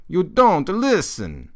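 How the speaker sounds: put-on slow, loud Italian-accented grandpa line. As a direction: echo the Italian grandfather's scolding, drawn out. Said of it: "in a distinctly Italian accent".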